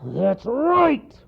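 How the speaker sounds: loud, high-strained angry roar; big gruff storybook bear; bear's roar. A narrator's reading. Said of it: "roared the bear".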